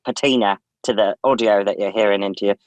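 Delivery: polished British accent, smooth and even. Shown as a demonstration. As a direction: speak like a BBC presenter. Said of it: "I change my voice like this".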